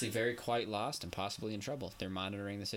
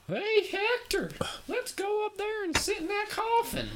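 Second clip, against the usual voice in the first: hammed-up Southern accent, much higher and louder, drawn out slow; bad Southern accent; 9 dB louder, slower than usual. Mocking a Southern idiot who'd sit in the coffin, in an awful Southern accent. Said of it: "That was the worst Southern accent ever".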